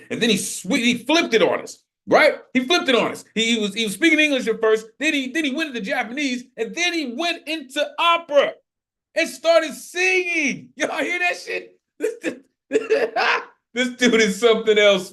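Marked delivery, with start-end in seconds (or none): 4.6-10.7 excited retelling. Real, not acted. Host excited retelling the poet's switch. 10.8-15.1 high laughing amazement; laughing in disbelief. Real, not acted. Host laughing to the room about the poet.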